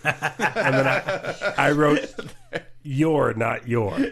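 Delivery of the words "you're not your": slow deadpan. Retold or imitated. Reading out his own reply.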